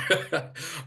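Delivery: a brief, high burst of laughter; short laugh. Real, not acted. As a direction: laugh at your own unfinished joke. Laughs after unfinished joke.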